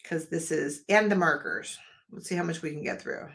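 usual speaking voice

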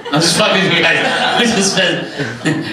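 ordinary talk, nothing marked

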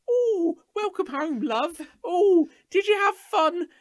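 very high, loud doting mum voice; hammed-up shrill-pitched mum fussing over a homecoming; British mother. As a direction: squeal it out like a caricature mum. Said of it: "like, mother, and you know what it will be like".

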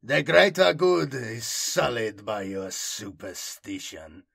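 slow, cold pronouncement through battlesuit emitters; harsh pilot's voice. A narrator's reading. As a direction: condemn them harshly, word by word. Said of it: "came the pilot's harsh voice".

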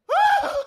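very high, loud shriek of alarm; panicked scream. Retold or imitated. Realizing he's at the counter, a loud panicked shriek.

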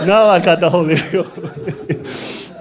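slow, laughing through "now I got the Holy Ghost"; joyful laughing. Real, not acted. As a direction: let the joy spill out, stretching the words.

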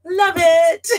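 put-on high, loud childlike love it; little girl voice. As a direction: squeal it like a small girl. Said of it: "So I just wrote".

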